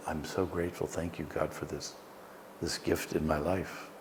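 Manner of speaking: quiet inner prayer. Shown as a demonstration. Teacher showing the words she says to herself, hushed and soft. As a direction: breathe the words like a private prayer. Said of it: "I like to say to myself".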